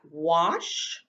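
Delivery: drawn-out wash; slow sounded word. Shown as a demonstration. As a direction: stretch the word for the class. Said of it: "That's the S H sound".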